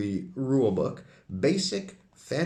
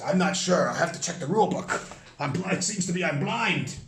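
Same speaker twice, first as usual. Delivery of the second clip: character voice; 6 semitones higher than usual. Acted. Galandon voiced in dialogue.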